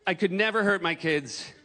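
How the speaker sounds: ordinary talk, nothing marked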